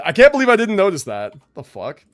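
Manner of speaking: normal talking voice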